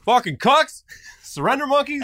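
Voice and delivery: high, loud hammed-up taunt; mock jeering. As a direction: hurl mock insults, half laughing. Mock insults hurled at the French.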